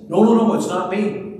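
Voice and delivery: high, loud panicked denial; Peter, cornered, high and loud denial; frantic denial. Retold or imitated. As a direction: deny it in a rush of fear. Peter denies knowing Jesus.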